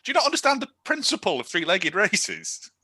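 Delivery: high-pitched mock disbelief; baffled outburst. Recounting bafflement at the film.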